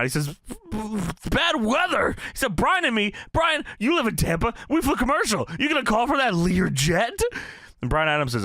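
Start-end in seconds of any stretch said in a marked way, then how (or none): 1.3-7.2 mock flipping out, pitched high; hammed-up outrage without much extra volume; Horace Hogan. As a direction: retell it as high-pitched indignant disbelief. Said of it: "Horace Hogan's flipping out".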